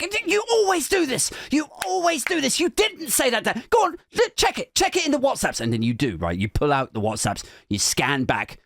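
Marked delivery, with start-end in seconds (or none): 0.0-5.6 heated wife. Retold or imitated. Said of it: "And she's just like".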